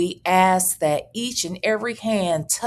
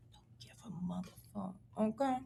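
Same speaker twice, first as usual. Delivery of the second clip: whisper; 3 semitones lower, 18 dB quieter, much faster than usual. Real, not acted. Censors a cuss word.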